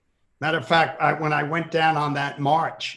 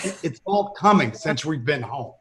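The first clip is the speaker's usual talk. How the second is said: ordinary talk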